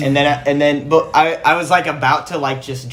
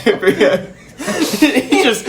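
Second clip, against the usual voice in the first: laughing fit; 4 dB louder than usual. Real, not acted. Cracking up at joke.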